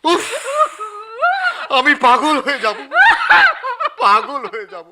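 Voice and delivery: despairing outcry. Acted. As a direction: cry out at your wits' end. Editor in despair. (হতাশ চিৎকার)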